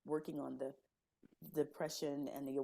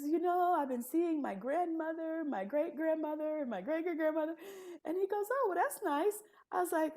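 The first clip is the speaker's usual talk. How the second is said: high, laughing voice through the story; giggly retelling; 12 semitones higher, 5 dB louder than usual. Real, not acted. Telling the host about the therapist, giggling. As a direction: still amused, voice up high.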